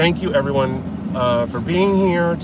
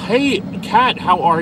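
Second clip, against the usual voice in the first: high, bright hello to a friend; light up on seeing her name; excited greeting; 8 semitones higher, 6 dB louder than usual. Real, not acted. Streamer spotting a regular in chat, excited hello.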